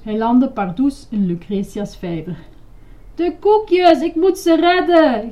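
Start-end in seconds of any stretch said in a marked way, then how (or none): 3.1-5.3 high, loud alarmed yell. A narrator's reading. Grover rescuing cookies in the pond, yelling. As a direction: shriek it in mock panic. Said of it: "gilde Grover".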